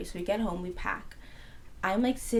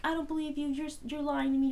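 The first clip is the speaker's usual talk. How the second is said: higher-pitched imitation of a suspicious mother; mock accusation in a raised pitch; accusing mom; 6 semitones higher than usual. Mom doubts daughter's late flight, imitated high accusing voice.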